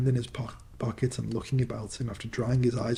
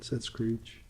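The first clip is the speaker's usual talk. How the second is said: plain reading voice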